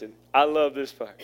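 ordinary talk, nothing marked

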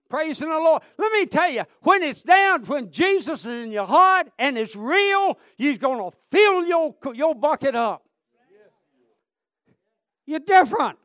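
loud, high revival shout; fervent pulpit cry; full-force preaching. Real, not acted. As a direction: preach it high and hard to the crowd.

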